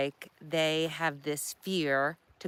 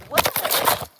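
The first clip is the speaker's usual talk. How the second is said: startled whoa; 4 dB louder than usual. Real, not acted. Camera slipping off the bicycle.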